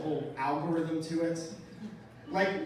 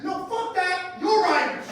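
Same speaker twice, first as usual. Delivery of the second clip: loud, much higher outraged shout; drunk guy yelling; 11 semitones higher, 8 dB louder, slower than usual. Retold or imitated. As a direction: yell it like a drunk refusing the answer. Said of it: "drunk guy insisting".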